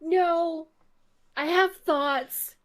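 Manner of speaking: high, loud, emphatic refusal; hammed-up urgent objection; acted insistence. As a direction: put your foot down theatrically, word by word. Acts out own insistent objection.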